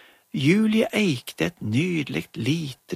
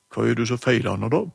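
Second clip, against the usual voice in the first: gruff doctor voice; 3 semitones lower, faster than usual. Acted. Emma as doctor answers phone, gruffly. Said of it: "hun gjorde seg veldig grov i målet".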